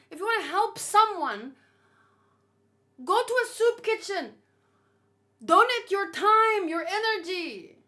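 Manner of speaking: loud ranting plea to the complainers. Real, not acted. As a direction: rant at the complainers, full force. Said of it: "my rant".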